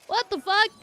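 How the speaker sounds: normal talking voice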